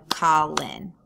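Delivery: Colin broken into two separate beats, Col-in; sounded out by syllables. Shown as a demonstration. Teacher showing students how to count syllables, Colin sounded out. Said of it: "sounded this out by going".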